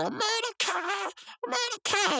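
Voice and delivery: high, drawn-out mock cry for help; panicked calling. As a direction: call a friend in cartoon panic. Rigby calls for Mordecai in fright.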